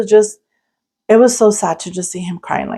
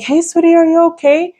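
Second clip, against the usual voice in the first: tender comforting; 9 semitones higher than usual. Retold or imitated. Woman through car window comforting lost crying boy, tender. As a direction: gently reassure a scared little boy.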